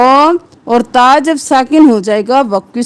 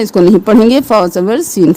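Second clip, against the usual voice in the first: normal talking voice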